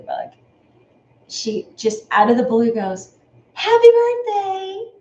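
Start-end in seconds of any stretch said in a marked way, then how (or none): 3.5-4.9 put-on much higher, slow, sweet toddler cheer; mimicking a toddler's sudden sweet greeting; little girl voice. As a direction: imitate a tiny girl calling out brightly. Toddler suddenly wishing happy birthday.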